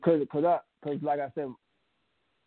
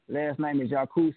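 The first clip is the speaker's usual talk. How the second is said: ordinary talk, nothing marked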